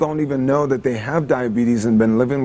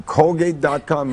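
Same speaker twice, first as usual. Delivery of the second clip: normal talking voice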